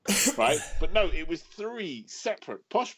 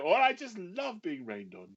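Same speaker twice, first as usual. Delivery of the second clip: mock sarcastic mum; 7 semitones higher, 3 dB quieter than usual. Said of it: "she used to say things like".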